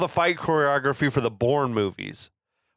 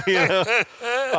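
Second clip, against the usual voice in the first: laughing; 10 semitones higher than usual. Real, not acted. Laughing at own impression.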